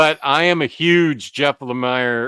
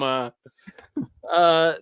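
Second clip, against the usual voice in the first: ordinary talk, nothing marked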